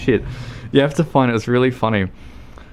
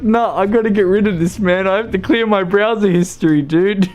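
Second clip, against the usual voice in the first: high, loud, giggly panic; mock horrified viewer; 9 semitones higher, 4 dB louder than usual. Viewer must clear browser history, high mock panic.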